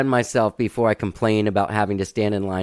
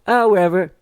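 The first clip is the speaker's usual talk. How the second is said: lightly higher, carefree reply; casual, a touch higher and louder; breezy shrug; 7 semitones higher, 4 dB louder than usual. Retold or imitated. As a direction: toss it off without a care. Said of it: "And I'm like".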